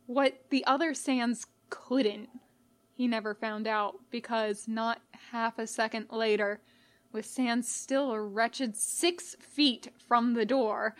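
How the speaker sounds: usual speaking voice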